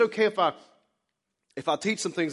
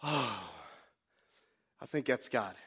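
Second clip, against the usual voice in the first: relieved wife; 7 semitones lower, 9 dB quieter than usual. Retold or imitated. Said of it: "she's like".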